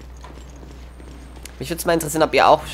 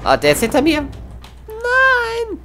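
panicked high yell; being chased; 3 dB louder, slower than usual. Real, not acted. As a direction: scream as a zombie grabs you. Zombie attacks from behind.

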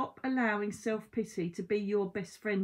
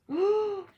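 gasp; 11 semitones higher, 6 dB louder than usual. Real, not acted. Drawing a shocking tarot card.

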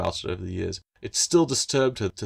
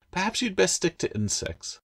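put-on high, dry girl's teasing; 11 semitones higher than usual. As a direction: tease him lightly. Claire teasing gecko.

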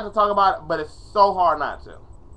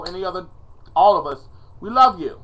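ordinary talk, nothing marked

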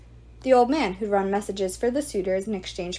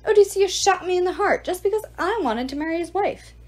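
higher, louder aggrieved whine; lightly voiced self-pitying ghost; whining ghost; 9 semitones higher, 4 dB louder than usual. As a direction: complain like a sulking ghost. Ghost complains of his death.

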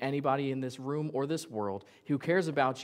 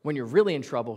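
ordinary delivery

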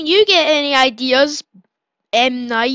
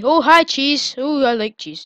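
ordinary delivery

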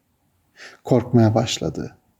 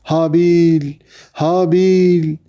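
higher, long-held desperate call; 5 semitones higher, much slower than usual. A narrator's reading. Kabil shaking his silent brother, drawn-out calling. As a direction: cry out the name, stretching it. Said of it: "şiddetle sarsarak bağırdı".

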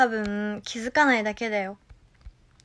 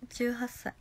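soft whispered aside; 9 dB quieter, slower than usual. Real, not acted. Repeating her age to chat in a whisper. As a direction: breathe it quietly.

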